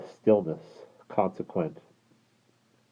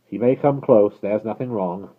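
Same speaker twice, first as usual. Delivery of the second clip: calling out; 4 semitones higher, 8 dB louder than usual. Acted. Passenger reassuring guard.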